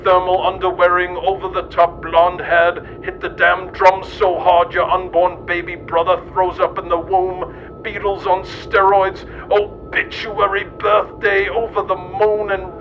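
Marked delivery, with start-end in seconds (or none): none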